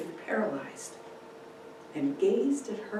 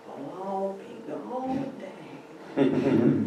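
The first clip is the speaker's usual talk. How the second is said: muttered; much slower than usual. Acted. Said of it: "he muttered".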